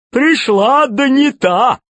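high, slow jolly villain; fat pirate voice. Acted. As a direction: play the grinning pirate boss. Tolstyak mocking.

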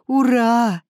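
a loud, high hooray; a boy cheers finding his teacher, shouting; cheering shout. A narrator's reading. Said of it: "кричал я".

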